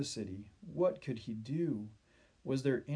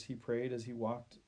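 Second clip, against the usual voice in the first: ordinary delivery, nothing marked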